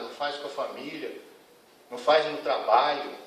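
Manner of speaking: normal talking voice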